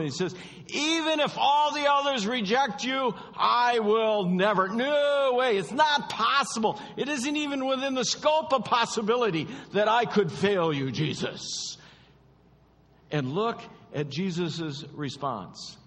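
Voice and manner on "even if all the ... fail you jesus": high, loud, proud protest; boastful Peter. Acted. Preacher acting out Peter, high and loud boast. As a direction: play Peter bragging he could never fail.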